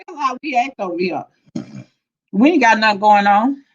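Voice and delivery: usual speaking voice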